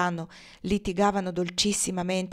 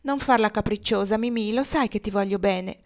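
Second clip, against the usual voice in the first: usual speaking voice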